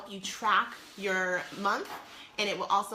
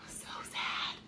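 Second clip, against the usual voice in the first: whisper; 8 dB quieter than usual. Real, not acted. Mourns giving up crawdads.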